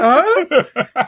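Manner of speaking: sudden puzzled "huh"; confused huh. Retold or imitated. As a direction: play it baffled and caught off guard. Imitating a character's confusion.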